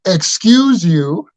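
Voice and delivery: high, slow, drawn-out "Excuse you" with a rising tune; mock the indignant lady, stretching it out; a haughty stranger scolding someone who brushed past; snooty old lady. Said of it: "But she goes".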